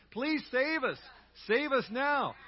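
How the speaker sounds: call and response. Shown as a demonstration. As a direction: proclaim it so the whole church joins in. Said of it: "Say that with me".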